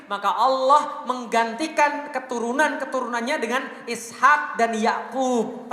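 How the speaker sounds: loud, slow and drawn out; emphatic slow climax. Real, not acted. Preacher driving the lesson home to his audience, loud and slow. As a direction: hammer the point home slowly.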